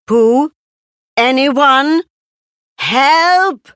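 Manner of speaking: yelling for help. Acted. Christopher Robin stuck in a trap calling for help, high and loud. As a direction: shout up from the pit for help. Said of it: "Christopher Robin yelled".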